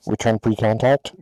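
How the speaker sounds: loud, crisp tanker radio call over the headset. Retold or imitated. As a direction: bark it like a boom operator on the radio. Tanker boom operator radio call.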